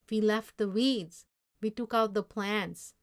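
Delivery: plain reading voice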